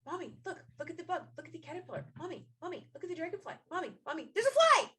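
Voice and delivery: put-on high, excited little kid pestering mom; constantly chattering child. Small child showing mom every bug, high-pitched and insistent. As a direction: imitate a small child's nonstop calls, building to a loud squeal. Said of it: "there was constant noise".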